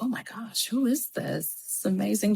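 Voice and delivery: awed hushed whisper, almost no voice. Retold or imitated. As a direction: breathe it out in wonder. Awestruck inner thought, retold.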